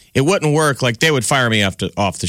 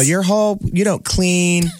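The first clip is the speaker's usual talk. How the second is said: much higher nagging wife voice; mock new wife; 10 semitones higher than usual. New wife scolding the husband in a high mock voice. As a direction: squeak out the complaint like a prim new wife. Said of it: "It's like".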